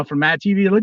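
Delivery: normal talking voice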